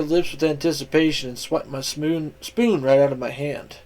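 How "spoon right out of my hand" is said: ordinary delivery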